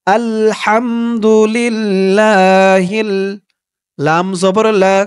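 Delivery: slow, drawn-out chanted recitation; recited Arabic dua. Shown as a demonstration. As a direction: stretch every syllable like tilawat. Model recitation. (ধীর সুরেলা তিলাওয়াত)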